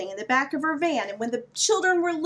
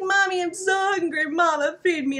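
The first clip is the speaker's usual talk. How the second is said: put-on whiny hungry kid; 5 semitones higher than usual. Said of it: "they'd be like".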